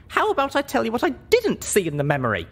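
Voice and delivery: put-on a high young heroine pushing back at the Elder; heroine's voice. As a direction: stand tall and push back.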